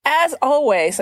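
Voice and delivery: ordinary delivery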